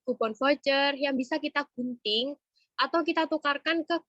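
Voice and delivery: normal talking voice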